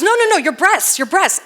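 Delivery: the doctor flustered, insisting on the right pose. Retold or imitated. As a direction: correct her in a hurry, pitch jumping up. Flustered doctor correcting her.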